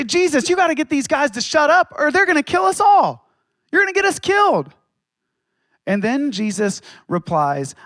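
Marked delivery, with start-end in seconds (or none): 0.0-4.7 panicked plea. Acted. Panicked Pharisees plead with Jesus.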